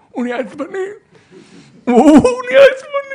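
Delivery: high, loud whiny wail; mock whine. Wife complaining her husband gets angry, in a mock wail. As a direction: whimper it like a teary complaint.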